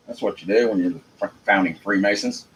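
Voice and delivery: normal talking voice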